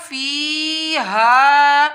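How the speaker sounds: فیہا with both long vowels drawn out very slowly; stretched recitation. Shown as a demonstration. Teacher slowly stretching فیہا in recitation. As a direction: hold فی and ہا each a full count. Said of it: "اس کو ایک الف کے برابر کھینچا جائے گا".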